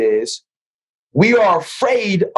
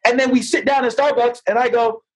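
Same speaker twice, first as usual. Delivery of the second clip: ordinary talk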